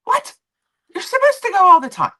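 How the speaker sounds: high, loud disbelief; incredulous retort. Retold or imitated. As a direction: exclaim it in shrill-pitched disbelief. Incredulous retort in retold exchange.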